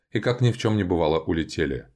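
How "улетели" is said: ordinary delivery, nothing marked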